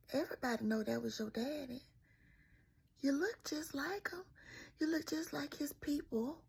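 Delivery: country teacher. Retold or imitated. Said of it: "that's how they talk. We from the country".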